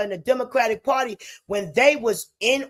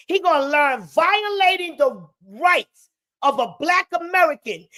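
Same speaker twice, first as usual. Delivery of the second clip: raised, furious ranting voice; angry shout; 5 semitones higher, 3 dB louder than usual. Real, not acted. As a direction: shout the threat in outrage. Angry rant at landlord.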